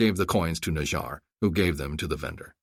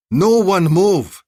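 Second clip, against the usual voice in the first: loud, slow barked order; shouted command; 11 semitones higher, 10 dB louder, much slower than usual. Acted. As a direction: shout it down at them.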